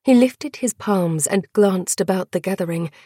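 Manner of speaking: ordinary delivery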